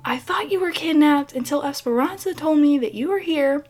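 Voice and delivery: high, alarmed mom calling out; mother who panicked, now scolding. Retold or imitated.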